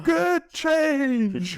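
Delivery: very high, loud, stretched-out imitation yell; mock distant shout. Heard after three hard strokes, imitated high shout. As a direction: imitate a piercing call across the water. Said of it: "and then we hear, like".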